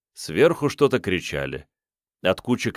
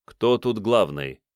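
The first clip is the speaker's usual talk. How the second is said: ordinary delivery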